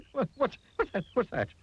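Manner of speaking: very high, stuttering squeal; terrified shriek. A narrator's reading. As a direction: jump in terror at a snap. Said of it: "he shrieked".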